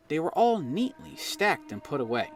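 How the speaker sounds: ordinary delivery, nothing marked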